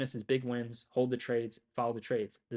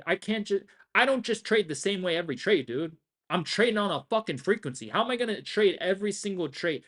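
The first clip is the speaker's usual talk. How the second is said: worked-up rant; 5 semitones higher, 3 dB louder than usual. Real, not acted. Hyped rant about trading frequency.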